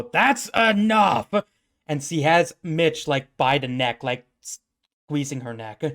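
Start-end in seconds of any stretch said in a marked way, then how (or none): 0.0-1.3 commanding shout, higher and drawn out; booming order, pitched up; loud roar. Acted. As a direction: roar it slowly to stop a fight. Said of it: "you hear a loud roar go".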